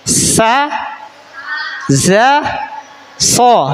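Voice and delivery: sa, za, so, each letter said cleanly and apart; letter demo. Shown as a demonstration. Teacher asks class to practise, models letters clearly. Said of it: "Coba praktekin".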